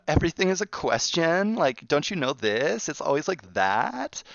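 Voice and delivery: high, every line rising like a question; mock valley girl uptalk, pitched high; ditzy valley girl. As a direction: play the airhead who makes everything a question. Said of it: "the stereotypical blonde bimbo girl".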